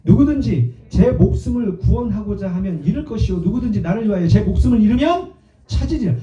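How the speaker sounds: loud, measured pulpit reading; declamatory Bible recitation; scripture reading. Acted. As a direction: read the verse out with force. Reads scripture to congregation.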